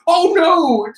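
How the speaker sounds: high, stammering mock panic; stating the obvious. Minion on another panel, high mock-panicked shouting. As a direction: wail it like a hammed-up dim minion. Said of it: "they just go stating the obvious".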